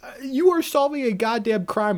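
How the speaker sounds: high, loud comic exasperation; worked-up mock outrage; incredulous rant. Real, not acted. Comic outrage at the sausage detail.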